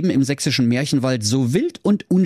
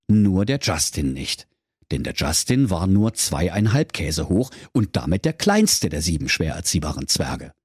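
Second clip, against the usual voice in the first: ordinary delivery, nothing marked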